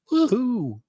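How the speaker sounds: high little cheer; sudden high woohoo. Acted. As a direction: toss in a gleeful whoop. Cheer inside a legal reading.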